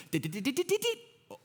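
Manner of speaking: rising ratchet clicks; rapid dits climbing very high; imitated clicking. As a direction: mimic a restraint ratcheting tighter. Imitates the seat restraint clicking.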